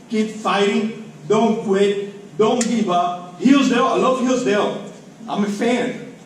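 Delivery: ordinary delivery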